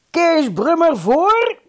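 high, loud, drawn-out summons; slow, high-pitched loud call; shout. A narrator's reading. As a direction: shout him up to the front. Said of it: "schreeuwde Pleunius".

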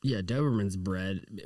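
ordinary delivery

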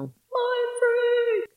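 high, far-off showman shout; Criss Angel catchphrase. Retold or imitated. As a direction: belt it like a TV magician.

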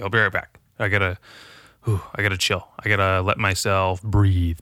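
normal talking voice

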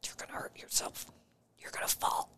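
hushed whisper, barely voiced; whispered warning. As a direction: whisper it as if holding back from saying it aloud. Holding back from warning gym-goer.

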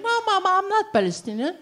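high, quick dog voice; matter-of-fact pet correcting Mama; the little dog answering. Acted.

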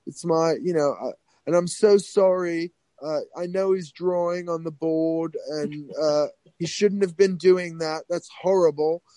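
apologetic British accent, imitated; British grandmother. Grandmother apologizing to the school boss, mock British accent. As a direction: put on a fussy, mortified British accent. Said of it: "she was British".

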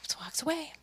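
hushed amazement. Real, not acted. Storyteller marvelling at the neighbor's exit, hushed. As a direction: drop to a near-whisper for the repeat.

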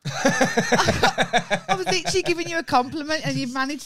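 loud, high, laughing indignation; laughing protest. Real, not acted. Partner defending a compliment, laughing protest. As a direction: protest loudly through laughter.

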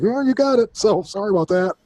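high, breezy imitation of a doctor; mock doctor. Doctor telling patient he tested positive, mock high voice. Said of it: "And the doctor was like".

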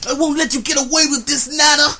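shouted high anime villain line; overacted anime dub. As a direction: yell it like a dubbed anime hero.

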